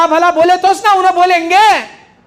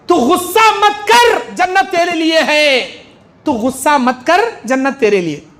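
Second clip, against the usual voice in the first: ordinary delivery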